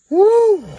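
high loud whoop from the heat; burning mouth. Real, not acted. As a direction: gasp it out as the spice hits. Reacting to hot pepper bean.

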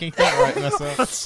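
a laughing hillbilly voice; mock Southern drawl. Teasing a friend in a high, laughing Southern voice. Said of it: "Ain't nothing like that Southern crack".

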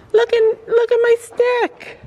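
high soothing pony voice. Real, not acted. Owner calming pony near the stick, high coaxing praise. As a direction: reassure a frightened animal up high.

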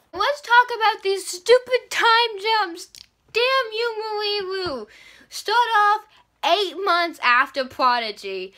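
loud, high, slow exasperation; mock rant. Real, not acted. Viewers hear her rant at the author, loud and mock-angry. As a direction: shake a fist at the author. Ranting at the time jumps.

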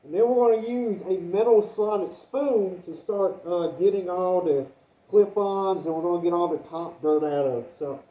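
muffled behind a respirator mask; talking through a half mask respirator. Real, not acted. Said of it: "through the respirator here".

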